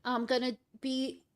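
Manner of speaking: ordinary talk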